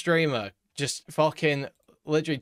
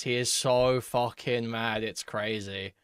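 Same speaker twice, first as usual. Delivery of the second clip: ordinary delivery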